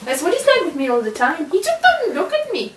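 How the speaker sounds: usual speaking voice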